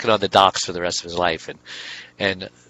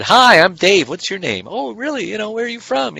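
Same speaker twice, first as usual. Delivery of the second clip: mock eager small talk at a party; forced extrovert cheer; 10 semitones higher than usual. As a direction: put on a forced social-butterfly voice. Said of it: "pretend that I'm an extrovert".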